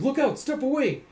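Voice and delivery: high, loud acted warning; urgent warning shout. Doctor warning boy away from patient, urgent mock shout.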